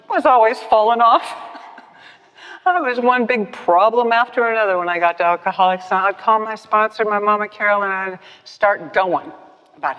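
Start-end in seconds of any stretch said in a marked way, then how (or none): 0.1-4.9 louder, laughing admission; telling the meeting of her mess, laughing. Real, not acted. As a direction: laugh at your own troubles.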